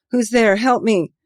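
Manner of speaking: high, loud cry for help; terrified plea. Acted. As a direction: beg for help in panic. Trapped man crying for help.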